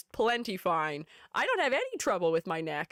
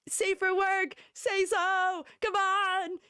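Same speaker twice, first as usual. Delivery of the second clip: higher, playful scolding shout at chat; teasing call-out; 8 semitones higher, much slower than usual. Real, not acted. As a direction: call out to chat, half-laughing.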